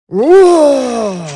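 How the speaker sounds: loud fierce lion roar. A narrator's reading. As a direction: roar to send her running. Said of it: "one of his best roars".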